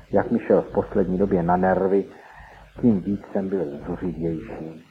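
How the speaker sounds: ordinary delivery, nothing marked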